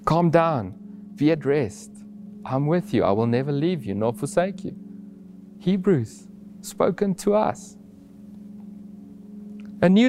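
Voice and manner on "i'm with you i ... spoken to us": ordinary talk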